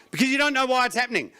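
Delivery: ordinary talk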